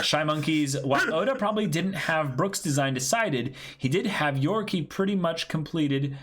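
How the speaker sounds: bright, high chat-reading voice, pitched well up; upbeat message read. Acted. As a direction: read the donation out like a cheerful announcement.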